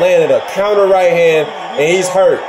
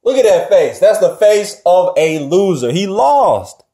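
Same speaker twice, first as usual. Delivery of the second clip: ordinary talk, nothing marked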